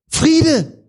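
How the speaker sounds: put-on high, loud child's call of safety. Preacher imitating children calling out, loud high call. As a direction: shout it like a kid reaching base.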